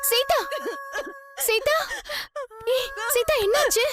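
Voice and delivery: high, anxious calling out; alarmed concern. Acted. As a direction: call to her in rising alarm. Finds the beaten, crying Sita. (பதறிப்போய் அழைத்தல்)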